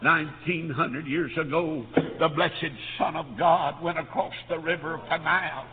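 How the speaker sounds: ordinary talk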